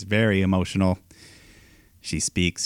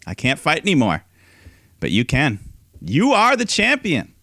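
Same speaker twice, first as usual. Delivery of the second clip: heartfelt handover; 6 semitones higher, 3 dB louder than usual. Retold or imitated. Host voicing Becky handing Asuka the title, heartfelt. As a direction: lift it up as a gift. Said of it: "And, uh".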